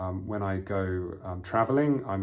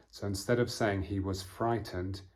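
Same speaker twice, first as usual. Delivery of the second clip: usual speaking voice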